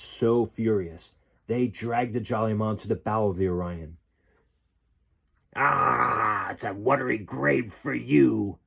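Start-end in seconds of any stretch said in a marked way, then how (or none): 5.5-8.6 loud, gruff pirate threat; pirate shout. A narrator's reading. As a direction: bark the threat at the captive. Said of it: "one of them shouted".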